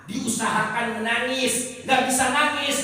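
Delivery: high, loud, forceful; fiery preaching. Real, not acted. Preacher urging the crowd to pray in earnest, loudly. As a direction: hammer the point home.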